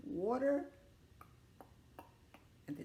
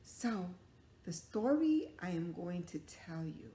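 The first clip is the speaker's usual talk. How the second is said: ordinary delivery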